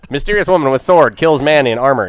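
loud newsreel headline voice; old newsreel. Pretend. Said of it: "do it in the newsreal voice".

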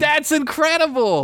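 high, thrilled exclamation; amazed. Real, not acted. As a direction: gush with amazement. Astonished at fun fact.

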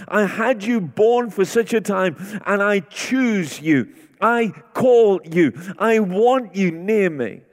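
preacher voicing God's invitation to the church, fervent; impassioned preaching. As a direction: press the invitation home. Voicing God's call to listeners.

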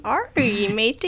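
slow gruff pirate growl. Pretend. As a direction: drawl it like a pirate. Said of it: "What if it's, like, a pirate voice?".

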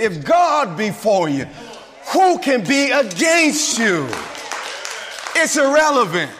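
preaching at full force. Acted. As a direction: drive the point home to the congregation.

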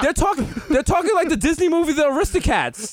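high-pitched mock bewilderment; confused Americans. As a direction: play baffled viewers, voice shooting up. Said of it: "All the Americans are confused".

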